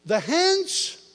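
high, loud proclamation; preaching outburst. Real, not acted. Preacher calling out to the church. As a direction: announce it with force.

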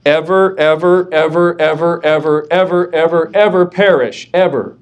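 each ever stacked on the last, rising insistence; endless repeated ever. Shown as a demonstration. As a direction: keep piling on "ever, ever" to drive it home. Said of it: "That's how emphatic it is".

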